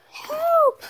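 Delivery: a sudden high pretend scream for help; cry for help. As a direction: call out like a kidnapped princess. Captured Peach cries out.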